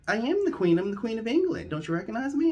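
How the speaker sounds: high, loud, offended royal insistence; indignant Queen. Retold or imitated. Offended Queen insists on her identity.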